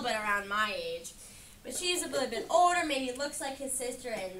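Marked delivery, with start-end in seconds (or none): none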